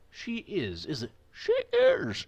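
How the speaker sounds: falsetto try, slow and high; failed high father voice; attempted high voice. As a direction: squeak it up high, then give up. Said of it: "I can't do it".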